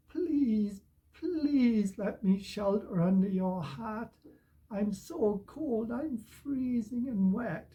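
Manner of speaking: high, slow, drawn-out storybook pleading; begging. Acted. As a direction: beg for shelter like a cold, wet cat. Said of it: "and then they beg".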